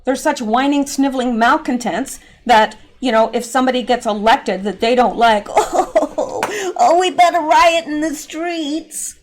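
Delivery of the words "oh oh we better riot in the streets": laughing, high mock whine, drawn out slow; mocking whiny protester. Mocking Democrats as crybabies, a high slow whine. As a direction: sneer it like a spoiled crybaby.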